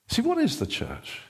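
ordinary talk, nothing marked

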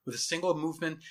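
plain reading voice

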